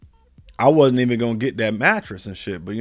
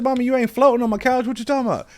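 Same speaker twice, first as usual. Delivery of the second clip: high disbelieving voice; mock mom's excuse; incredulous retort; 11 semitones higher, faster than usual. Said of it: "I said".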